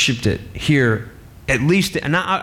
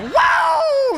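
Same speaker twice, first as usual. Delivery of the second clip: much higher, loud wrestler whoop through a laugh; Ric Flair woo; 3 dB louder than usual. Acted. Adam waking up and seeing the woman, Ric Flair whoop. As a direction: let out a wild showman's whoop. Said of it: "Like a Ric Flair moment".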